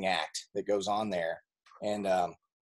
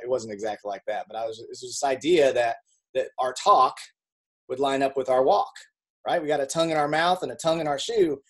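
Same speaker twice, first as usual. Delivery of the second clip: ordinary talk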